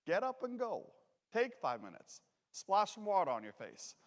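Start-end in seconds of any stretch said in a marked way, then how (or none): none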